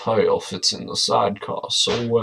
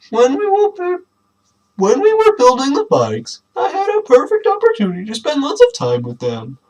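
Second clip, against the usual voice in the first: lightly higher dad character voice; high Clem voice; 5 dB louder than usual. Acted. Reading dad's lines to the family in a raised voice. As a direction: read Clem's lines up high and bright.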